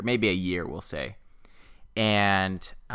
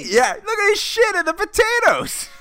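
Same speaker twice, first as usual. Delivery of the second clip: very high laughing voice; laughing moviegoer. Retold or imitated. Friend joining the popcorn guy, high laughing squeal. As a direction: play along with a squealing laugh.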